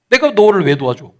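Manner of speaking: mock-indignant question, lifted at the start; brush-off. Ungrateful person refusing someone who once helped, dismissive. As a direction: shrug off the plea as none of your business. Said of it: "나 몰라라는 거죠".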